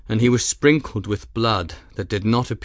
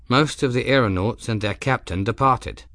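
ordinary delivery

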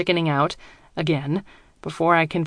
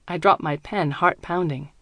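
ordinary delivery, nothing marked

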